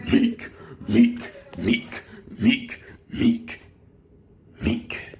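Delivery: spaced, squeaked impact sounds; shockwave hit noises. Retold or imitated. Storyteller imitating shockwaves of hits, spaced squeaky noises. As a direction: squeak out each impact separately. You hear these shockwaves.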